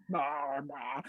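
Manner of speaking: very low, soft meows; cat meow. Acted. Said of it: "random animal sounds".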